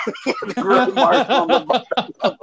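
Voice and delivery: high, helpless giggling through the words; real laughter overtaking the sentence; laughing. Real, not acted. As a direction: crack up while telling it. Cracking up at own story.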